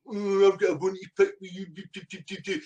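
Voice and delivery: mock a pest picking a fight; mocking whiny boy. Man imitating his rival picking a bone, mockingly. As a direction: mimic a petty complainer, trailing into babble. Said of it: "comes in with his wee fucking boy".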